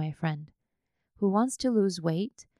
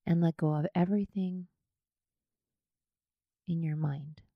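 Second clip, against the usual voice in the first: unhurried yoga nidra guidance, leaving space after each phrase; guided meditation; 5 dB quieter than usual. Acted.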